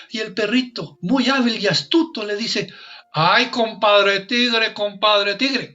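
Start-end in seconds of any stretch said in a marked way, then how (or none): 3.1-5.7 put-on slow, drawn-out Colombian coastal accent; a sly dog pleading in a Colombian accent. Dog begging the tiger in a Colombian accent. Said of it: "con el mejor acento colombiano".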